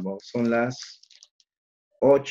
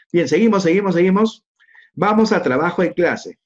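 louder, higher, driving onward; rally the class to keep going; brisk teacher energy, pressing forward; energetic push on; 7 semitones higher, 8 dB louder than usual. Real, not acted. Teacher pushing the lesson on.

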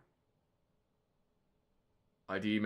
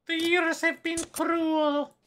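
squeaky voiced character; slower than usual. Game character line voiced.

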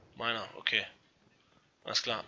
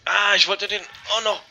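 excited; 6 semitones higher, 9 dB louder than usual. Real, not acted. Player shouting over teammate's capture.

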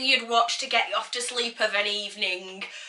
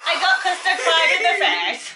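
laughing; 5 semitones higher than usual. Real, not acted. Laughing after being pied.

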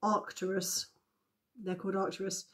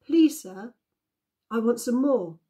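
raised, measured polite request; polite plea; 3 semitones higher, 6 dB louder than usual. A narrator's reading. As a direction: plead politely as a timid orphan. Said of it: "in his most polite voice".

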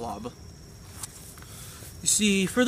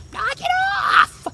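sharp shout; 5 dB louder than usual. Retold or imitated. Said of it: "funny scenes".

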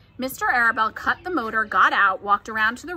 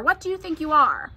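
higher-pitched scolding question; stern command; 5 semitones higher, 4 dB louder than usual. A narrator's reading. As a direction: demand it as an exasperated mother. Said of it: "commanded his mother".

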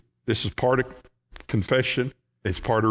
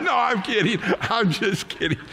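laughing; 8 semitones higher than usual. Real, not acted. Preacher laughing at own joke.

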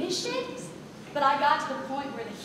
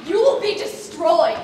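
loud mock-menacing threat; 9 dB louder than usual. Stage manager hamming a threat at the cast. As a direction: threaten them, hammed up.

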